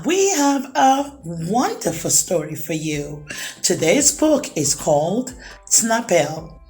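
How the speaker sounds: ordinary delivery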